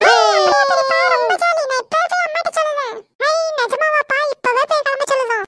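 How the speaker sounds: put-on high, thrilled child's cheering; overjoyed. Children told by uncle of an Ooty trip, overjoyed. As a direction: burst out in high-pitched delight.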